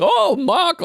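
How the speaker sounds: high squeaky attempt at an Aussie girl; a woman swooning over Mark, badly accented; failed Australian accent. Host mimicking Aussie surfer girl in a high voice. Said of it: "I can't do the voices".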